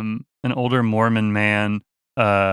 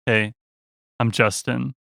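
glum greeting; slower than usual. Retold or imitated. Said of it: "sorry, wrong tone".

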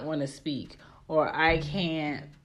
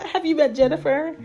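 laughing through it, higher; laughing answer; 7 semitones higher, 5 dB louder than usual. Real, not acted. Answering a viewer about her stubborn mom, laughing. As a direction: crack up mid-answer.

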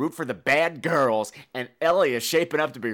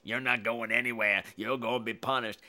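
mock burly brute; 6 semitones lower, 5 dB quieter than usual. Burly man threatening the runaway boy, low mock menace. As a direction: lower growly-ish brute voice for the ugly man. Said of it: "burly, ugly man comes popping out like".

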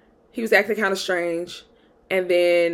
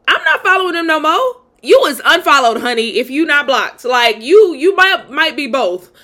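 higher-pitched, playful sass to the ladies; sassy declaration; 6 semitones higher, 5 dB louder than usual. Acted. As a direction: lay down the law with exaggerated sass.

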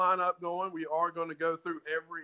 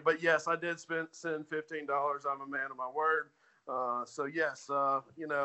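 usual speaking voice

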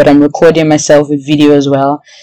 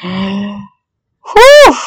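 loud gasp of relief; 4 semitones higher, 4 dB louder than usual. Real, not acted. Relief after sending.

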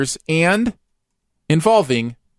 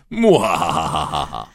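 slow, low evil cackle; maniacal laugh; 11 semitones lower, much slower than usual. Pretend. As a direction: cackle like a mad scientist. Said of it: "You forgot the maniacal laugh".

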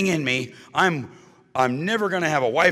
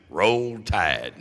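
Alabama goodbye; 4 semitones lower than usual. Retold or imitated. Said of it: "this is how they say goodbye in Alabama".

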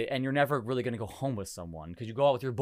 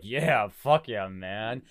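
slow, drawn-out macho bro voice; straight character; 3 semitones lower, much slower than usual. Acted. Playing his straight character for Italian riders, slow bro drawl. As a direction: stretch it like a tough bro. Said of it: "practice my straight character".

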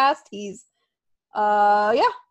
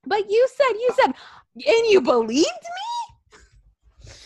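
mock outraged wife; 11 semitones higher, slower than usual. Acted quarrel, wife's voice.

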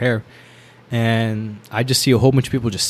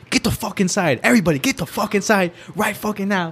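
imitated drill instructor; 9 semitones higher than usual. Officer herding new recruits through the door, mock yelling. Acting the officer barking orders inside.

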